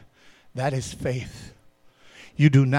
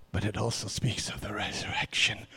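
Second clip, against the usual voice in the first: whisper; 5 semitones lower, 8 dB quieter than usual. Acted. Hushed turn to resurrection.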